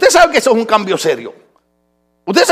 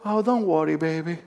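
soft reassurance; 5 semitones lower, 10 dB quieter than usual. Retold or imitated. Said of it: "Le digo".

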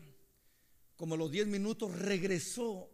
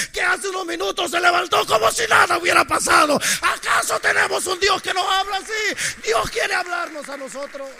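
loud, shouting testimony climax; fiery preaching; 8 semitones higher, 11 dB louder than usual. Acted. Preacher shouting the grandmother's healing to the congregation. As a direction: drive the miracle home to the church.

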